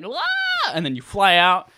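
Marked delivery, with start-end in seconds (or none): 0.0-0.7 high startled yelp; sudden mock fright. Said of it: "you're like".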